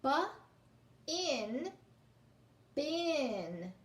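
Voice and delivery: "B", "in", then blend "bin"; sounding out. Shown as a demonstration. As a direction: break it into sounds. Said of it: "So it's P in pin".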